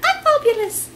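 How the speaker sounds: high squeaky cartoon cat; tiny cute cartoon voice; Hello Kitty voice. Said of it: "Going to interview Hello Kitty".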